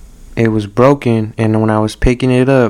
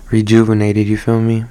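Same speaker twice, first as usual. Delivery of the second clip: normal talking voice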